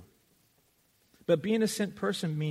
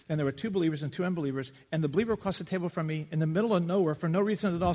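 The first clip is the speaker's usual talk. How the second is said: ordinary talk, nothing marked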